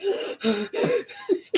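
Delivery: high gasp held in; re-enacted squeal-stifling gasp; suppressed starstruck gasp. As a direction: gasp and fight to contain the excitement. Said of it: "trying to hold it in".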